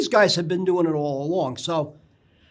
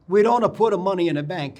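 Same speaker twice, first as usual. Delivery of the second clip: put-on thick Italian accent, a bit louder; heavy Italian accent; 2 semitones higher, 4 dB louder than usual. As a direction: imitate an old Italian immigrant's accent. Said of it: "in a very heavy Italian accent says to me".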